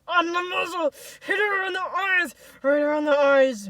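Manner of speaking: high, loud shouted orders; forceful high-pitched yell; shouting. A narrator's reading. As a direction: shout it out to egg on the whippers. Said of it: "Misha shouts".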